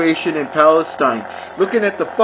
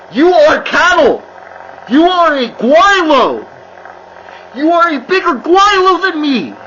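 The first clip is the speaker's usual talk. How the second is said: angry shout; 7 semitones higher, 5 dB louder than usual. Real, not acted. Ranting insult at Americans.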